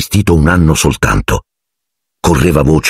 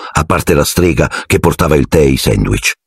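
usual speaking voice

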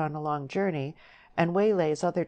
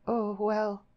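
put-on higher-pitched, weary young woman's reply; weary concession; 6 semitones higher than usual. A narrator's reading. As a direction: give in with a tired "oh, well". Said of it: "Deborah spoke wearily".

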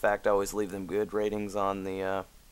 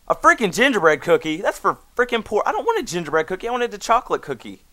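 higher, louder and fast, outraged whine; indignant rant; 10 semitones higher, 6 dB louder, faster than usual. Real, not acted. Ranting at the cookie the GM sent, indignant and loud.

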